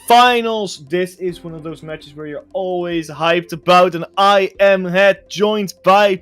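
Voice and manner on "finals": announcer drawl. Acted. Hyped intro, stretched word.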